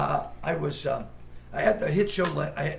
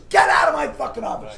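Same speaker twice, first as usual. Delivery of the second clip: loud, higher furious yell; retold shout; 10 semitones higher, 9 dB louder than usual. Director throwing the comic out of the audition, furious shout. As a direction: scream him out of the room. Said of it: "And he goes".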